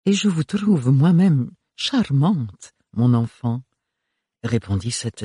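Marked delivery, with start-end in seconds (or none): 0.1-3.6 slow, higher purring compliment; gracious grande dame. Acted. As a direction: return the compliment with stately charm.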